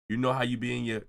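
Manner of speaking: usual speaking voice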